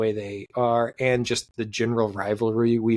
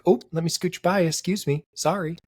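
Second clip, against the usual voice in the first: higher, lightly voiced overly polite passerby; polite Saint Louis local; 7 semitones higher than usual. Imitating a polite Saint Louis stranger bumped into, higher and apologetic. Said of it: "they're like".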